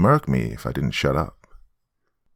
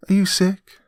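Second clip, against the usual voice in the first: put-on high-pitched questioning child voice; little girl; 14 semitones higher than usual. Daughter finding Uncle Terrence, little-girl voice. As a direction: call out as a puzzled little girl.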